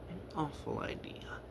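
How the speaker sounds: hushed, relishing the word awful; wicked whisper. A narrator's reading. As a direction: savour the wickedness softly. Wicked idea.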